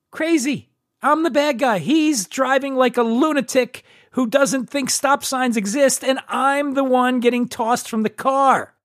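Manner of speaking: high-pitched, drawn-out incredulous rant; indignant disbelief. Real, not acted. Indignant punchline of ride rant.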